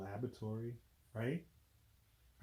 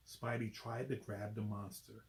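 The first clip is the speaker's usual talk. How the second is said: ordinary delivery, nothing marked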